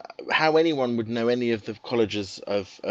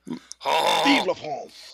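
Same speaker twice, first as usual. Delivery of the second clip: slow, drawn-out mock French accent; 4 semitones higher, much slower than usual. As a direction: stretch it out in a higher French accent. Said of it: "Please do a French accent for me".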